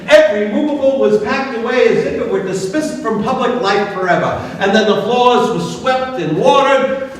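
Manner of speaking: plain reading voice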